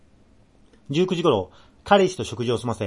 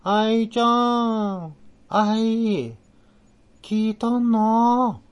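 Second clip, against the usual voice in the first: high, very slow, muffled eerie call in Nagoya dialect; muffled ghostly sister voice; 11 semitones higher, 4 dB louder, much slower than usual. A narrator's reading. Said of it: "またくぐもった私の声で". (家の中から妹を呼ぶ、こもった不気味な姉の声)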